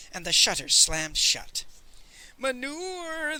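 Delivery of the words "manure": high, long-drawn mournful hawker's call, softened; street cry. A narrator's reading. Peasant hawking manure in the street, crying out. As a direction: sing it out high and sorrowfully. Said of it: "the old peasant cried".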